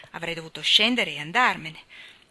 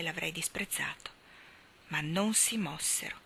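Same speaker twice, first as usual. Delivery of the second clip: usual speaking voice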